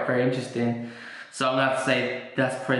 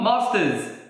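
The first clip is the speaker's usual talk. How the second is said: usual speaking voice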